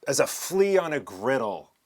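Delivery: loud, slow teasing tag; teasing punchline. Real, not acted. Teasing her nerves.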